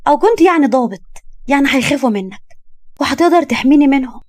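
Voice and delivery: cheekily talking him into it; persuasive young woman. Acted. Young woman pleads her case.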